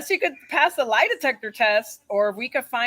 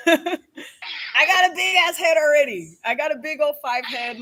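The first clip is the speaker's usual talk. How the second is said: laughing; 5 semitones higher, 4 dB louder than usual. Real, not acted. Joking with a friend about her big head, laughing. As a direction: giggle it out at your own joke.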